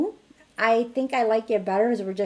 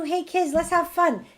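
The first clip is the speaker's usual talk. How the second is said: high, bright call to play; rally the kids, lighten the mood; upbeat invitation to play; cheery call to kids; 8 semitones higher than usual. Shown as a demonstration.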